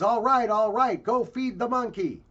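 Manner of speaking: put-on loud, high, giving in to a screaming child; acted mom snapping under pressure; exasperated mother. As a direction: cave in loudly, fed up. Worn-down mommy gives in.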